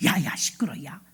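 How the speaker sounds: low, very soft shushing; hushing whisper. Acted. Soldiers telling young David to be quiet, hushed. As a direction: shut someone up under your breath. Soldiers hush the indignant boy.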